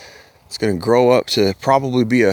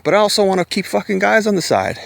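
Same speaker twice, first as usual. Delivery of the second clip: higher, whiny mock woman voice; mocking party woman; 8 semitones higher, faster than usual. Mocking women who want babies and partying, higher and whiny. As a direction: lightly parody a woman wanting it all.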